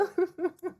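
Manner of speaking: a quick, high burst of laughter at her own memory; short laugh. Real, not acted. Woman laughing at her own crisis story, brief high laugh. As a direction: laugh it off, amused by how absurd it was.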